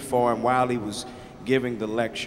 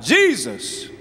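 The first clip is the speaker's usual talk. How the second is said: put-on a boy's eager yell, pitched higher; hollered out loud; 8 semitones higher, 5 dB louder than usual. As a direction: shout the answer out proudly. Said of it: "And he hollered out loud".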